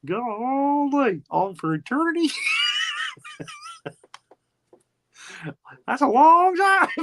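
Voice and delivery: high, drawn-out goofy yokel amazement; hammed-up gee-whiz reaction; mock dismay. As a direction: stretch it out in comic disbelief. Mocking Frank's reaction to eternity.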